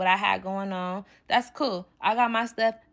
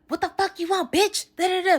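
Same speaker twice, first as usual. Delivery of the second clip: imitating his high, sneering yell; mock the man going off on the phone; mock angry man; 11 semitones higher than usual. Teller mimics the man cursing out her friend, as a high mock yell.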